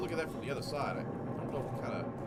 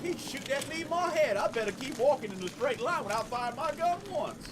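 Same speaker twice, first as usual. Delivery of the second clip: a higher-pitched goofy voice for the clueless goon; mocking dumb enemy; 13 semitones higher, 7 dB louder than usual. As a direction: play the enemy as an oblivious idiot. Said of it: "He's like".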